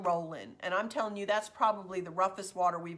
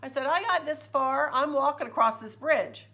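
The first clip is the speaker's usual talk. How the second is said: higher, louder, emphatic retelling of her refusal; stubborn, raised-voice determination; defiant refusal; 7 semitones higher, 6 dB louder than usual. Retold or imitated. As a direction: dig your heels in, loud and high.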